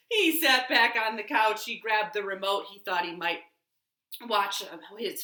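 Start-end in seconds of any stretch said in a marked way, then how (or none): none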